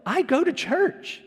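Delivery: high, slow mock whine of "I go to church"; high, drawn-out mocking of a proud attender; mock self-important. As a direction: play the entitled churchgoer, smug and slow. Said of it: "I've heard 'em pull it".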